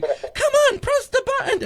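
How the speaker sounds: very high, impatient; squeaky impatient. Retold or imitated. Urging friend at the phone, squeaky high and impatient. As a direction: pitch way up, nagging.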